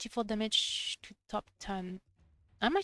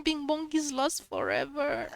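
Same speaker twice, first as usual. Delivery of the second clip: higher, louder, slow hammed-up sob; mock crying; 6 semitones higher, 4 dB louder than usual. Streamer mourning lost companion Bing Bong, hammed-up sob. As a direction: wail it like a fake tragic mourner. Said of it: "I'm gonna cry in my glass".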